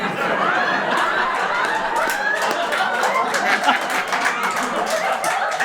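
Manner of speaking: high, loud cackling laugh; hideous laughter. Acted. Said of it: "that's Tasha's hideous laughter".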